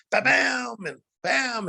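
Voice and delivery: drawn-out, high-pitched bams; imitated kick impacts. Fighter retelling bag kicks, high imitated impacts. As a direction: stretch each bam up high like a hit.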